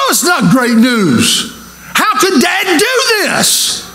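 high, slow, indignant disbelief; older brother appalled at the party; outraged brother. Retold or imitated. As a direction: sputter in jealous outrage at the news. Elder brother outraged at news.

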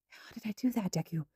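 hushed. Acted. Toga shaken, hushed.

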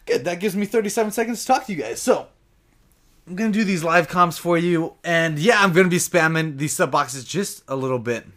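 ordinary talk, nothing marked

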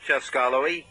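usual speaking voice

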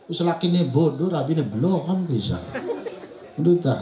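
usual speaking voice

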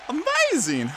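pitched high in delight; excited. Real, not acted. Gamer celebrating the win, high excited cheer.

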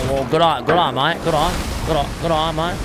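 broad Australian good on mate; hammed-up Aussie cheer; Aussie accent. Streamer thanking a fan in his Aussie accent. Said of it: "epic Aussie accent".

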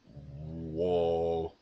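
low drawn-out mock awe; low whoa. Shown as a demonstration. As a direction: stretch out a deep whoa. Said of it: "the variations of how I react".

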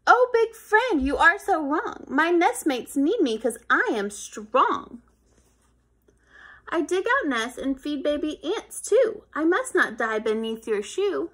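proud ant. Acted. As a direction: stand up for your nest. Ant argues for its life.

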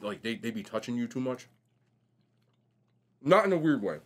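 ordinary delivery

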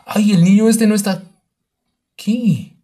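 high, loud alarmed outburst; alarmed exclaim. Real, not acted. Baby missing from the crib.

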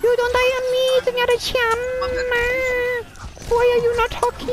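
Mercy voice. Pretend. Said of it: "This is the Mercy voice!".

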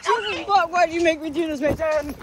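high, shocked after a dash; breathless disbelief. Real, not acted. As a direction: gasp it out in disbelief. After running across road.

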